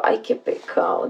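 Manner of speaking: breathy whispered "ay, qué pecado"; whispered pity. Retold or imitated. Streamer recalling her reaction, whispered pity. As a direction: whisper your pity for him.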